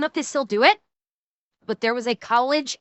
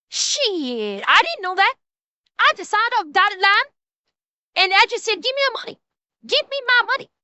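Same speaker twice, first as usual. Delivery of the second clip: put-on Southern accent; 4 semitones higher than usual. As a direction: play it up with a Southern twang. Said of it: "I'm doing a Southern accent".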